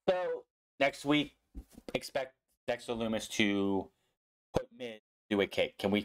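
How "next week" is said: normal talking voice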